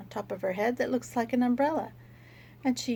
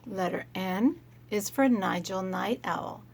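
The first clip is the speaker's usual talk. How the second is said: ordinary talk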